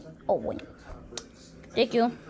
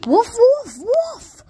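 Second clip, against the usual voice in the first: high, loud mock yelps; crying wolf; 10 semitones higher, 5 dB louder, much slower than usual. Trapped player calling wolf to a partner, high playful yelping. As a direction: yelp it way up high.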